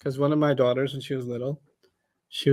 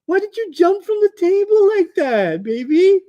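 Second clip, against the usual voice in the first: soothing parent; 12 semitones higher, 4 dB louder than usual. Retold or imitated. Mother comforting crying child.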